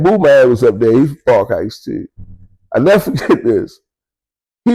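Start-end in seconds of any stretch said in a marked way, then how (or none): none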